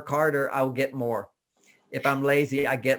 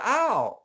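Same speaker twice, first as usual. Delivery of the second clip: imitated yelp of pain; 8 semitones higher than usual. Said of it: "So you go".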